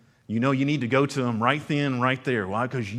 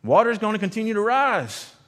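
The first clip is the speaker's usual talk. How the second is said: boat rescuer calling; 10 semitones higher, 6 dB louder than usual. Retold or imitated. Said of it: "a boat comes by and says".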